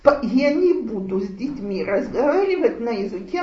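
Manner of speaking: ordinary delivery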